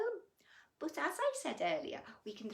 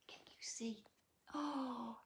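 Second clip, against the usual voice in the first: whisper; 8 dB quieter than usual. Real, not acted. Storyteller whispering to the little listeners, hushed. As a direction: lean in and whisper the question.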